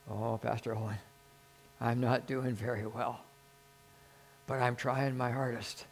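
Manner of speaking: put-on meek young convert.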